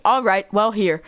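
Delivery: normal talking voice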